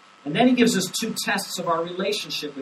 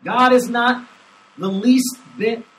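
higher and louder, slow on least bit; emphatic stress; 7 semitones higher, 12 dB louder, slower than usual. Shown as a demonstration. Pastor stressing the Greek emphasis, slow and loud. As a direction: press down on not and least. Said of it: "It's an emphatic statement".

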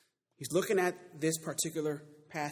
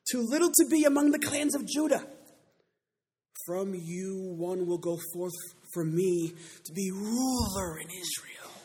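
starts high and loud, then slows solemnly; stately pulpit reading; scripture proclamation; 6 semitones higher, 7 dB louder than usual. Acted. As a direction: declaim the prophecy to the congregation. Preacher reads scripture aloud.

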